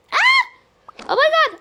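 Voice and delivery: high, loud shriek of fright; startled scream at a noise; terrified scream. Acted. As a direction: scream then gasp it out. Scared by noise.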